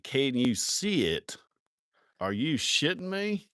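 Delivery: slow incredulous echo, rising high; mock incredulous. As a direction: throw the question back in exaggerated disbelief. Mocking his buddy's question back.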